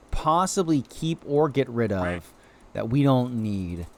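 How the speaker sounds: ordinary talk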